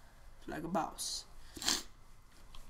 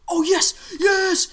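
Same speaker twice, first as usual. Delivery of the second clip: high, loud cheer; excited high-pitched celebration; ecstatic shout; 12 semitones higher, 13 dB louder, much slower than usual. Real, not acted. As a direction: yell it in real triumph. Celebrates a find in the game.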